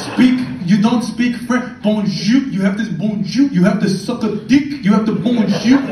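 loud, ranting mock Frenchman. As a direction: rail at the tourist like an angry local. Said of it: "they're like".

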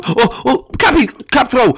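normal talking voice

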